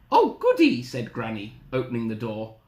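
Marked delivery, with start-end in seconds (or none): 0.1-0.8 put-on very high, loud old-lady glee. Granny hearing of breakfast, high delighted voice.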